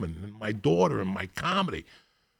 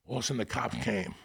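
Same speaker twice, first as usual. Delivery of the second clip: normal talking voice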